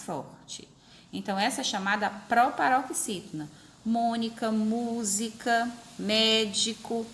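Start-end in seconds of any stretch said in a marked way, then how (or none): 3.8-7.1 "Mônica, música, médico" with long first syllables; stress on first syllables. Shown as a demonstration. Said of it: "tudo isso é proparoxítona".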